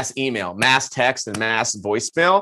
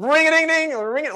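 high sing-song ringing noise; imitated phone ring. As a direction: chime it like a phone that won't stop.

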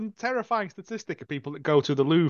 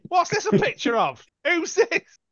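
very high exaggerated questions; hammed-up prying curiosity; mock nosy guest; 12 semitones higher, 4 dB louder, slower than usual. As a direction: squeak it like an over-curious visitor. Imitating nosy guest picking things up.

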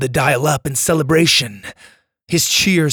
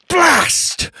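frustrated curse; 5 dB louder than usual. Acted. Dante punches the ice.

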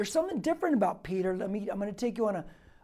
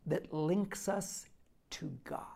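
slow, softer and softer until "God" is a whisper; hushed, fading to whisper; 2 semitones lower, 9 dB quieter, slower than usual. Acted. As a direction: let it die into a whisper. Preacher drops voice for effect.

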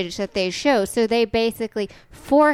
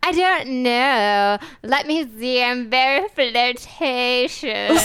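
put-on slow, drawling Southern belle accent; slower than usual. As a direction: play a flirty Southern belle, slowly. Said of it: "where are you from with that accent".

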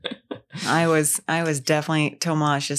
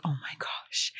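awed whisper; 3 semitones lower, 12 dB quieter than usual. Retold or imitated. Tomas's childlike wonder at Santa.

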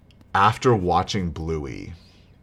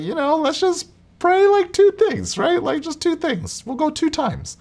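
very high, loud mock wife voice; husband mimics his wife in a high falsetto; imitating wife; 7 dB louder than usual. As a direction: squeak out the wife's bargain in a falsetto. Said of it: "she was like".

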